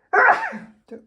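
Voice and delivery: another loud sneeze; a sharp sneeze bursting out; sneezing. Real, not acted. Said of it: "Oh, here we go again".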